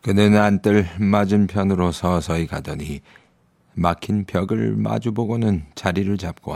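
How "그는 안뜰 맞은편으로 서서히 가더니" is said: ordinary delivery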